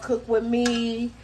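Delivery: normal talking voice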